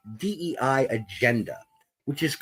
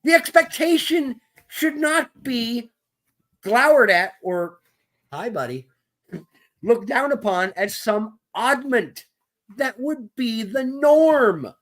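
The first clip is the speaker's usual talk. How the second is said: indignant rant; 9 semitones higher than usual. Real, not acted. Heated rant continues.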